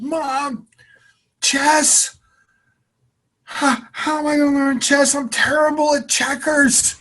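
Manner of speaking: put-on high, loud whiny kid voice; a tearful twelve-year-old protesting; upset young kid. Kid protesting to his mom.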